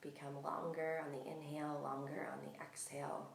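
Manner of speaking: calm, unhurried meditation voice with long pauses; guided meditation. Real, not acted. Yoga teacher settling listeners into breath awareness, calm guided meditation.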